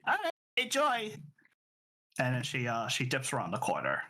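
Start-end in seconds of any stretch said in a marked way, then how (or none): 0.1-1.1 put-on high chirpy little old lady. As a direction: sing it out cheerily. GM voices old lady leaving.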